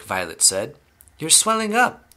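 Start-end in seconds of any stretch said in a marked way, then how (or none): 1.2-2.1 very high-pitched alarm; heightened mock scream. A narrator's reading. Mother alarmed at Violet swelling, high mock scream. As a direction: shoot the pitch right up in horror. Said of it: "screamed Missus Beauregard again".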